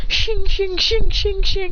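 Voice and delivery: high, rapid-fire blade sounds; sword-clash noises. A narrator's reading. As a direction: ring out each clash of steel. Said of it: "anime battle going".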